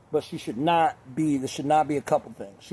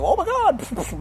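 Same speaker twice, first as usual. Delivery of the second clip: high-pitched scandalized gasp of words; mock shocked woman; 13 semitones higher, much slower than usual. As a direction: imitate a woman appalled by a joke, voice way up. Imitates an offended woman's reaction.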